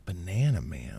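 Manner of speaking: low mock voiceover echo; deep echo. Echoing title in deep voice.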